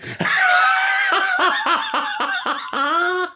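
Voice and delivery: long, high shrieking laugh; helpless laughing fit. Real, not acted. Laughing at their own innuendo joke, long high cackle. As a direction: howl with laughter till it runs out.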